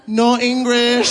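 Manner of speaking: high, slow "No English"; mock "No English". Comedian pretending to the couple he speaks no English, high and slow. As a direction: drag out "No English" to play dumb. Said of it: "before saying".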